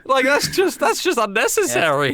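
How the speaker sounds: high, loud, laughing protest; indignant retort. Retold or imitated. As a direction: squeak out the objection between laughs. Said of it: "And I'm like".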